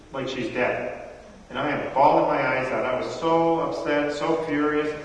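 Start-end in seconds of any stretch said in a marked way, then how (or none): none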